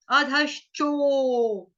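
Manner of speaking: अधश्चो very slow with a long ओ; slow demo. Shown as a demonstration. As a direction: draw out अधश्चो, each syllable apart. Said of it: "च के साथ ओ की मात्रा".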